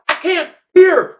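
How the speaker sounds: high, loud cry of complaint; deafened yell. Acted. Deafened Leonard to the line, yelled complaint. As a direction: yell as if you cannot hear yourself.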